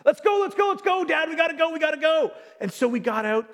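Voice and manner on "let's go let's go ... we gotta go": put-on much higher, louder eager child urging Dad; bouncing with impatience, hurry hurry; excited kid. Father imitating his son urging him outside, excited.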